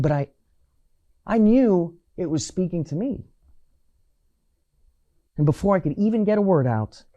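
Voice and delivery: ordinary delivery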